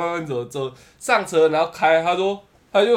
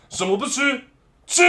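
stern officer command; 7 semitones higher than usual. Retold or imitated. Officer barks at the nervous cook.

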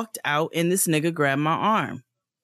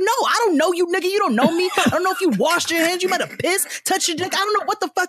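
furious retold yell; 12 semitones higher, faster than usual. Yelling at the man who grabbed her arm, much higher furious yell. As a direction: tear into him for grabbing you.

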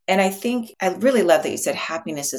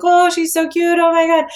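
much higher, louder gushing coo; imitating women cooing over a cute baby; 11 semitones higher, 7 dB louder than usual. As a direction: gush like someone melting over a baby. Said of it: "cooing and awing over the baby girl".

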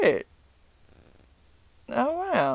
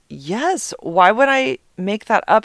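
higher-pitched, indignant retold reply; mock insistence; 7 semitones higher, 3 dB louder, slower than usual. Daughter insisting to mom her brother is sick, indignant. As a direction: push back as the disbelieved daughter.

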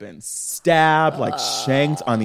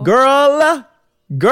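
high, loud drawn-out call; dramatic excitement; 11 semitones higher, 5 dB louder than usual. Real, not acted. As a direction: announce big news. Dramatic opener.

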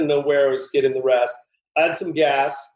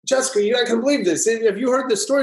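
excited Steven; 7 semitones higher, faster than usual. Retold or imitated. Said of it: "he was like".

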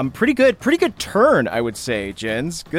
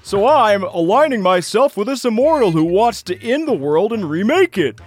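high, goofy cartoon speechifying; big, earnest lunkhead voice; Rockley, endearing dim rock-man; 7 semitones higher than usual. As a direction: declare your allegiance like a dopey henchman. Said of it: "your voice was endearing".

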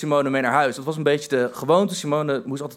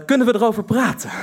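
meek plea; 5 semitones higher than usual. Retold or imitated. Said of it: "de woorden waar mijn vrienden mij mee plagen".

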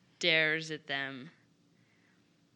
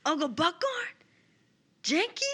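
tearful; 10 semitones higher than usual. Acted. Player repeats Boudreaux's call to caged family in Draconic, tearful. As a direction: call to family in a high, welling-up voice. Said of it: "so he starts crying. It starts welling up".

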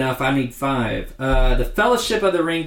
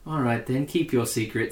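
soft, delicate Frodo-style line; 6 dB quieter than usual. Retold or imitated. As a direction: say it lightly and softly, imitating Frodo. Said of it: "it's the little delicate way he says it".

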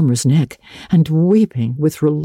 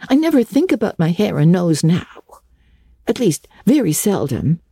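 lightly voiced young girl; 8 semitones higher than usual. A narrator's reading. Anne voiced higher.

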